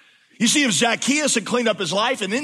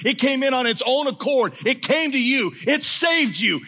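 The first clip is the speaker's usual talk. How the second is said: preaching; 5 semitones higher than usual. Real, not acted. Preaching builds to a climax.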